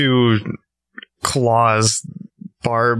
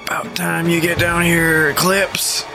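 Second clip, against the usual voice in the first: higher-pitched wolf character voice; 6 semitones higher than usual. Acted. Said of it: "I think that was [name]'s wolf voice".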